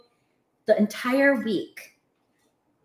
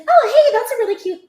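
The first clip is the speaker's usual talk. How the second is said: high, louder retold gush; gushing greeting; 9 dB louder than usual. Greeting Doc over his glass turtle, gushing. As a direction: chirp it high at a colleague.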